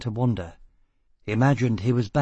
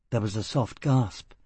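plain reading voice

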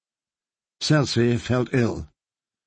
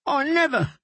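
desperate denial; 14 semitones higher than usual. Acted. Bard denies in terror.

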